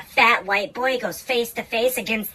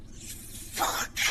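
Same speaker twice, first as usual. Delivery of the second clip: breathless whispered curse; whispered fuck; 12 dB quieter than usual. Retold or imitated. Telling about the boyfriend, a deflated whisper. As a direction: drop it to a disappointed whisper. Inner reaction after boyfriend reveal.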